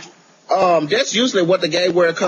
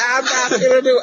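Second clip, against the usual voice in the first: ordinary delivery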